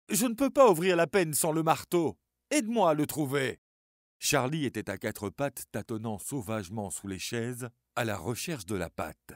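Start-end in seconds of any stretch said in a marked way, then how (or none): 0.1-3.6 high, loud, strained and panting plea; tense, gasping, begging for help; strained and breathless. Acted. Suzanne begs Charlie to help find the hammer, breathless. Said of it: "Sa voix était tendue et haletante".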